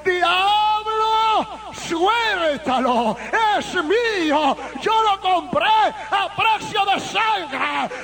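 high, slow, drawn-out command; voice with authority. Retold or imitated. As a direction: command the devil, stretching each cry. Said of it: "una voz con autoridad". (voz de autoridad, aguda y alargada)

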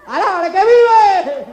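slow, drawn-out loud praise cry; preaching shout. Real, not acted. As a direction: belt it out to the congregation, slowly. Preacher's exclamation to congregation.

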